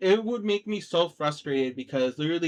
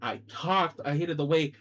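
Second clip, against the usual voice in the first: normal talking voice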